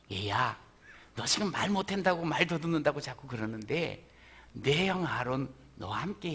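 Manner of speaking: soft, low fatherly comfort; gentle reassurance. Acted. God comforting stammering Moses, soft and tender. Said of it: "주님이 이렇게 말씀을 하세요".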